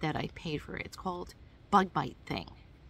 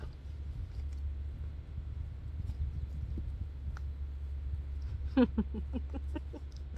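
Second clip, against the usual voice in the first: long laugh; 5 semitones higher, 4 dB quieter than usual. Real, not acted. Laughing at her own fumble with the knife.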